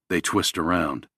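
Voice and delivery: ordinary delivery, nothing marked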